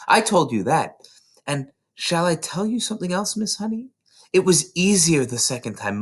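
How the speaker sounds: put-on high, bright child voice tumbling with excitement; eager little girl. A narrator's reading. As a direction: play Matilda bursting to tell her teacher. Matilda describing the feeling.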